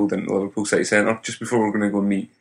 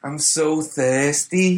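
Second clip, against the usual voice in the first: slow, higher Scouse drawl; Liverpool accent; 4 semitones higher, much slower than usual. Retold or imitated. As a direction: stretch it out like a Scouser. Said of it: "Is that your Liverpool accent?".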